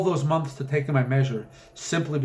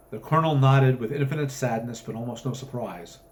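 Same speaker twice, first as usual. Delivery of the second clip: ordinary delivery, nothing marked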